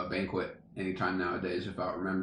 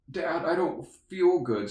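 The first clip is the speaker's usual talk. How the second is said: put-on whiny kid voice; 8 semitones higher, slower than usual. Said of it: "And he's like".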